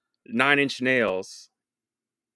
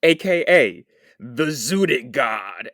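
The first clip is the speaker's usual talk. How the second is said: announcer voice; 4 dB louder, much slower than usual. Pretend. Hype-man style nickname intro.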